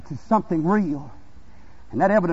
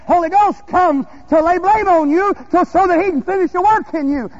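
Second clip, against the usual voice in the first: raised, high preaching voice; full-force preaching; 10 semitones higher, 6 dB louder than usual. Real, not acted. Preacher proclaiming what the Holy Ghost comes to do, full-force preaching. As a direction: ring it out to the congregation.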